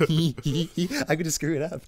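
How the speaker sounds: high mock giggling; mock giggle. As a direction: giggle it out in fun. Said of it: "He was like".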